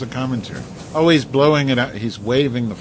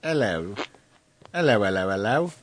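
put-on British accent; 3 semitones higher than usual. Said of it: "You gotta do British talk".

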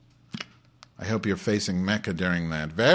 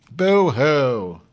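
loud, high fake boo-hoo; hammed-up self-pity; mock crying; 4 semitones higher, 6 dB louder than usual. Mock self-pity about fitness score.

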